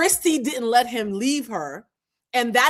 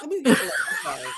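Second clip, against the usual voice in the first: usual speaking voice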